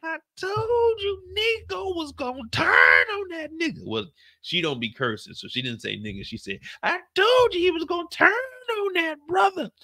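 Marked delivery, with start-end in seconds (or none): 0.0-3.8 high, slow, wailing cry; hammed-up tearful wife; mock crying wife. Kendall voiced crying out. 6.8-9.7 hammed-up tearful wife; mock crying wife. Kendall voiced crying again.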